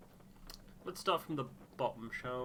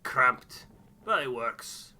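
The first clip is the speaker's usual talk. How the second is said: much louder, blunt and curt; gruff loud answer; 2 semitones higher, 12 dB louder, slower than usual. Acted. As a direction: bark the report out.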